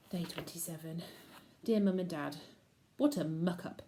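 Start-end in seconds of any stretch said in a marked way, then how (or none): none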